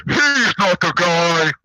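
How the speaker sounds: slow, low, drawn-out voice from off; announcing voice. Retold or imitated. Unseen voice interrupting the self-declared guy, slow low call. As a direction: stretch it out low like a proclamation. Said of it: "then we just hear".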